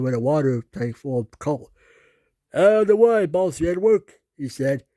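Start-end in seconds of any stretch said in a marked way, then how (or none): 2.5-4.0 bossy shout. Acted. James pushing Edward aside.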